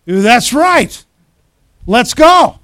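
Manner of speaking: loud, high, eager shout; acted charge-ahead enthusiasm; fired-up agreement. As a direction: answer the battle cry at full volume. Acting the fired-up reply.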